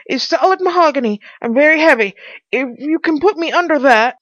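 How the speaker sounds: high, loud character voice; urgent Pycraft. Acted. Pycraft asking to be wedged under the table, urgent.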